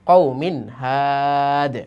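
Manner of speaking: কও মিন হাদ drawn out very slowly, ending on a clipped stop at হাদ; pause-form reading, the last word cut to হাদ and held slow; stopping form, waqf. Shown as a demonstration. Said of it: "একটু memorise করে দিলাম ওয়াকফের বিষয়টা". (ওয়াকফ করে খুব ধীরে, শেষে হাদ বলে থামা)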